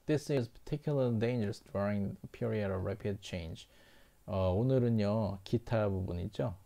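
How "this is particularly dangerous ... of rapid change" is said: slow example reading. Shown as a demonstration. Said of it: "teacher reads example sentence".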